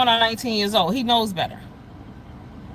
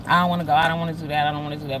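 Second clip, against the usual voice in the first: fast, whiny son refusing; whiny imitation; 7 semitones lower, much faster than usual. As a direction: mimic the kid's fast whining.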